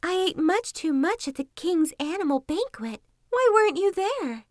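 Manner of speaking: high-pitched enthusiastic fox; boasting fox. A narrator's reading. Fox tempting wolf with a banquet, high and boastful.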